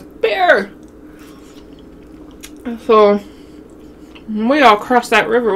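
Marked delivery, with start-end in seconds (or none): 0.0-0.7 sudden high-pitched cry of alarm; retold yell. Brother spotting the bear across the river, alarmed yell. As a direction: yell the warning, pitch shooting up. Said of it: "So my brother says".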